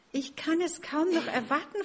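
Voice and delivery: can't wait, full of joy; joyful Max. Acted. Max looks forward to his chicks, high and eager. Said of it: "freute er sich".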